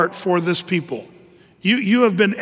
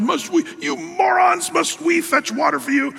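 mad Moses; 10 semitones higher than usual. Retold or imitated. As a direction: yell it down at the crowd in rage. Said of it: "But Moses is so mad".

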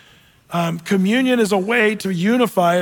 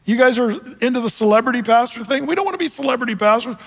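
incredulous, higher-pitched challenge; fired-up Paul; 4 semitones higher, faster than usual. Retold or imitated. Preacher voices Paul rebuking the divided Corinthians, fired up. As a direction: scold them in high, worked-up disbelief. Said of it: "Paul's fired up in chapter one".

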